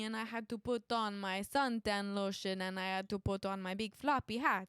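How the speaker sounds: put-on playful Italian accent. Game player switching accents mid-story on a shout-out, Italian accent. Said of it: "Italian accent".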